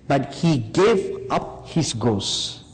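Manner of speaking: ordinary delivery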